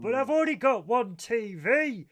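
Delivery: high, loud put-on despair; hammed-up tragic cry; mock dramatic lament. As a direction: wail it like a soap heroine.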